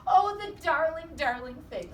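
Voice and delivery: high, loud doting; gushing Mrs Watson. A narrator's reading. Mrs Watson doting on the piglet, loud and high. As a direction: coo over the darling.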